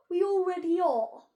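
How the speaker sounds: loud, high Florence cutting in; loud gruff friend. A narrator's reading. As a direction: call out boldly. Florence boasting.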